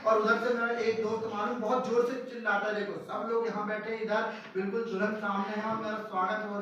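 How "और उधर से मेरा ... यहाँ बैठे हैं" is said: ordinary talk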